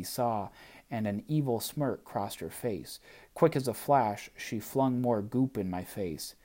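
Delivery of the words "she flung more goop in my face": plain reading voice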